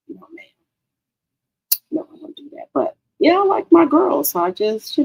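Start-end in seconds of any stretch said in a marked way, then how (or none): 0.1-2.7 whispered aside. Real, not acted. To her viewers about her language, a hushed whisper. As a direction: breathe it out as a secret. Denies cursing in professional settings.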